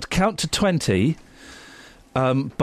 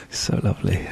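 whispering; 9 semitones lower than usual. Pretend. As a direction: breathe it into their ear. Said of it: "I'm whispering sweet nothings".

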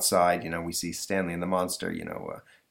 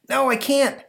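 put-on high, loud frightened boy; scared kid; 6 dB louder than usual. Boy stuck in a tree refusing, high and scared. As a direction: shout it down from the branch.

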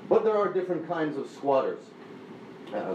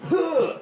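grunting; 5 dB louder than usual. Shown as a demonstration. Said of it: "a grunting sound".

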